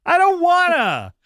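high, slow whine; whiny child voice. Pretend. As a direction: whine like a sulky kid. Whining refusal.

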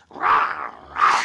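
low growling wolf rah. Acted.

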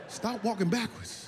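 usual speaking voice

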